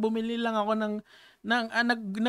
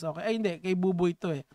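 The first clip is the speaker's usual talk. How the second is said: ordinary talk, nothing marked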